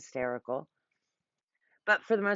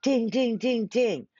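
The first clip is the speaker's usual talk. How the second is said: higher and louder, sung like a game-show bell; mock bell ding; 7 semitones higher, 6 dB louder, slower than usual. Realizing her friend is right, a bright ding-ding. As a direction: ring it out like a winning buzzer. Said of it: "she said that and I went".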